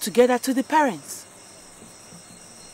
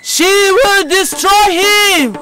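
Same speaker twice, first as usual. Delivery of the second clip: higher, louder, slow; slow loud proclamation; 10 semitones higher, 7 dB louder, much slower than usual. Acted. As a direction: drag out the doom loudly. Repeated prophecy, building.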